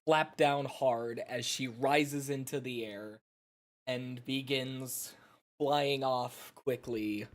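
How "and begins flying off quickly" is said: usual speaking voice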